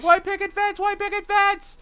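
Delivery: very high, frantic rabbit squeal; high squeaky panicked rabbit; mock panicked screaming. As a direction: shriek it while fleeing. Rabbits run screaming from room.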